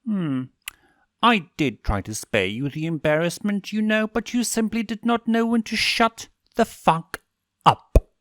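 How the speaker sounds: building to a snapped curse; enraged demon prince; menacing fury. A narrator's reading. Stolas threatens Veronica.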